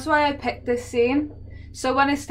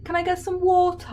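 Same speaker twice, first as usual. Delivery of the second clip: higher put-on English accent, as Americans imitate it; mock English accent; 8 semitones higher than usual. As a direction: parody an American trying to sound English. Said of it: "or whatever they do".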